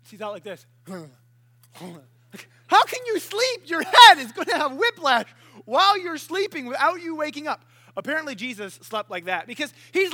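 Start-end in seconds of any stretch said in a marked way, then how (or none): 2.7-7.6 high, loud disbelieving rant; loud, high-pitched exasperation; mock incredulous outburst. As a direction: cry out in comic disbelief. Mock exasperation at sleeping friend.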